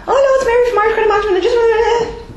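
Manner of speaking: high, fast, dreading the call; squeaky rushed reluctant caller; bad phone tone. Shown as a demonstration. Collector demoing the wrong tone on a call, high and rushed. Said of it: "The tone we use on the phone".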